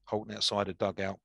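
ordinary delivery

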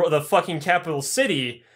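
ordinary talk

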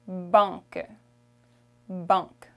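"banque" in French with nasal A; French pronunciation. Shown as a demonstration. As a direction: say "banque" through a nasal vowel. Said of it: "the A N is a nasal sound".

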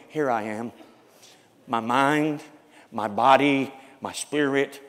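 whispered dedication; hushed prayer. Acted. Voiced surrender prayer.

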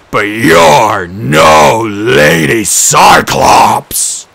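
very loud, very slow monster shout; the giant bursting out in fury; enraged roar. A narrator's reading. Cyclops enraged, tears dress.

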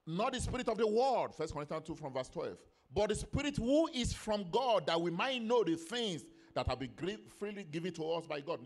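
loud, ringing scripture proclamation; forceful preaching. Real, not acted. Preacher reading Corinthians on the spirit from God, forceful preaching. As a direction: proclaim the verse in weighty phrases.

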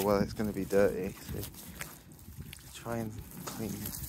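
ordinary talk, nothing marked

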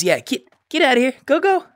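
high, fast shooing. Real, not acted. Gamer shooing away the onlookers in a high rush. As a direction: shoo them off hurriedly.

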